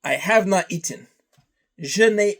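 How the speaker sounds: normal talking voice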